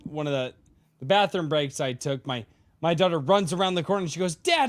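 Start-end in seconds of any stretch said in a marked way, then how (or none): 4.4-4.7 put-on child calling dad. Daughter calling out, retold.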